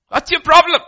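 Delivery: loud, high demand; scolding outburst. Real, not acted. Preacher voicing God's exasperation at grumblers, loud. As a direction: bark the question at them.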